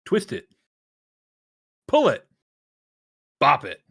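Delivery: high, loud toy announcer calls; Bop It toy voice. Pretend. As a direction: bark commands like a Bop It toy. Imitating Bop It toy commands.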